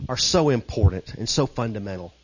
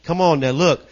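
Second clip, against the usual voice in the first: usual speaking voice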